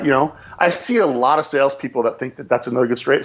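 ordinary talk

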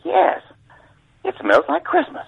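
very high put-on boss voice; imitated boss. Boss agreeing the pine smells nice, in high mimicry.

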